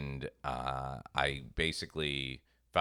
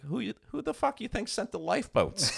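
higher, exasperated punchline; exasperated God. Retold or imitated. God answering the preacher in heaven, exasperated. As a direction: deliver the punchline as a fed-up God.

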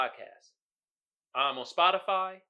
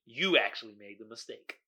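ordinary delivery

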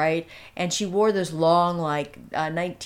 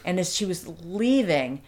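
normal talking voice

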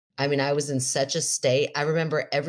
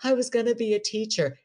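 high, plaintive imitation of weeping; mock hysterical crying; 8 semitones higher than usual. Crash victim tells medic her lost plans, mock-tearful. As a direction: wail it lightly, as if life is over. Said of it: "I start crying hysterically".

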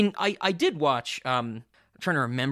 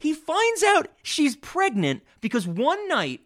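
very high, slow incredulous retelling; exasperated; 13 semitones higher than usual. Real, not acted. Critic explaining the pregnancy reveal to co-host, exasperated. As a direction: pitch it way up in disbelief at the plot.

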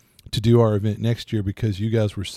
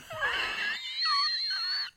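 high shrieking laugh; 8 dB quieter than usual. Real, not acted. Reacts to joke.

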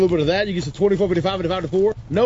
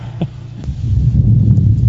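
loud cackling laugh; 7 dB louder than usual. Acted. Loud laugh after greeting.

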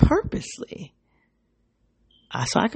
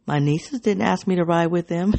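normal talking voice

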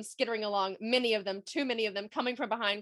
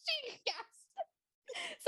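laughing; 5 dB quieter than usual. Real, not acted. Laughing while reading the story.

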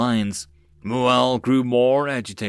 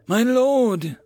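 desperate plea; 11 semitones higher, 5 dB louder than usual. A narrator's reading. Commander drops to his knees.

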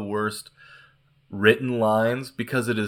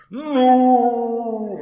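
long drawn-out, high, loud wail; hammed-up movie cry of "Nooo"; mock anguished "No"; 12 semitones higher, 5 dB louder than usual. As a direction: wail one endless "no" in mock agony. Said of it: "the no is bad".

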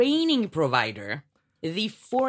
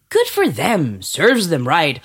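smug approval; 3 semitones lower, 6 dB louder, slower than usual. Acted. Voicing a smug viewer's reaction.

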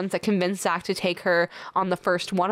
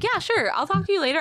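higher, casual mock brush-off; breezy brush-off; 11 semitones higher than usual. Zach brushing off the interrupting producer, casual. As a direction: toss it off lightly to get rid of her. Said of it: "and he's like".

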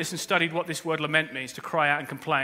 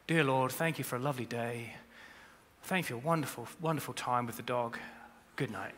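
softer, mechanical polite prayer; rote head prayer; 5 semitones lower, 9 dB quieter than usual. Pretend. Preacher mocking a dutiful prayer, flat and soft. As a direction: rattle off a bland bedtime prayer. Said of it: "don't just speak to me from your head".

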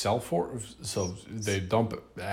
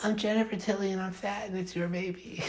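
mocking Jennifer Tilly; 11 semitones higher than usual. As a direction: squeal it, much higher. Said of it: "And she's like".

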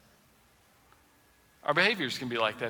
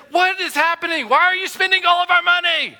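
mock exasperated husband; 9 dB louder than usual. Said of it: "you go to her and you say".

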